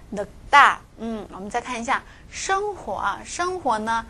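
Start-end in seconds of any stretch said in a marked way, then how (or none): 0.1-0.8 늙다 as 늑따, with a louder tense 따; tensed consonant. Shown as a demonstration. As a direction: demonstrate 늙다 with a hard, tense second syllable. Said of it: "要把这个다变成重音". (늙다的다读成重音따)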